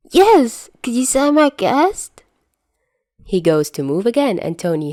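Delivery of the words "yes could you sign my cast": very high, loud and eager; eager kid. Acted. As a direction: beg like a starstruck little kid. Loopy Peter asks for autograph.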